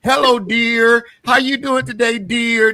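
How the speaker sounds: loud, high mocking of a scammer; imitated scammer. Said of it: "talking like".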